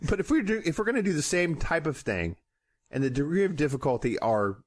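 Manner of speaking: ordinary talk, nothing marked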